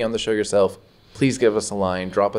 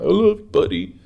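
much higher, louder goofy dad voice; imitating dad; 12 semitones higher, 4 dB louder than usual. Son mimicking his embarrassing dad saying he loves him. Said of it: "be embarrassing me and be like".

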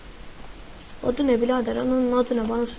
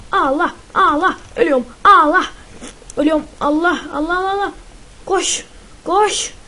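high, loud frightened cries of Allah; panicked cries; 5 semitones higher, 7 dB louder than usual. Real, not acted. Gamer panicking as enemies chase him. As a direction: cry out in real panic while fleeing.

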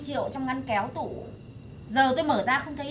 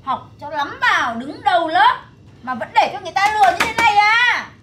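higher, louder frustrated scolding; exasperated scolding; 4 semitones higher, 5 dB louder than usual. Acted. Mother scolding her son for being fooled, exasperated and loud. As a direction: scold him in disbelief.

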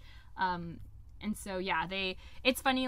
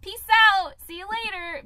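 catchphrase; 9 semitones higher, 8 dB louder than usual. Retold or imitated. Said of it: "those are two of my main catchphrases".

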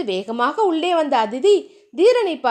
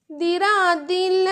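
put-on higher, slow plaintive girl's voice; Diya voice; 5 semitones higher, slower than usual. A narrator's reading. Diya objects to Dheeran, higher and slow. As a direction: stretch it out like a worried young wife.

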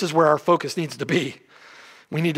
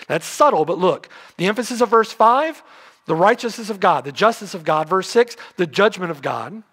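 ordinary talk, nothing marked

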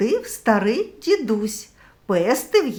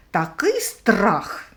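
ordinary delivery

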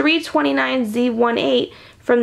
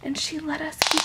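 plain reading voice

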